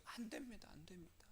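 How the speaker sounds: soft acted plea, barely voiced; hushed pleading. Moses begging God to spare the people, hushed plea. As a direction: beg under your breath.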